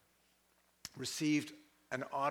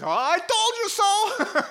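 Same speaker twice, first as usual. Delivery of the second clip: high, loud, drawn-out mock outburst; hammed-up vindicated wail; gloating doubter; 9 dB louder, slower than usual. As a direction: crow it mockingly over everyone. Himself as the doubter, crowing.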